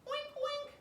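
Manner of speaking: very high oinking; pig oinks. Acted. Puppy George oinking, high. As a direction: oink like a pig. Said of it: "George went oink".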